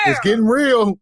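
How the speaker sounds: hyped reaction. Real, not acted. Cohost reacting to escalating argument.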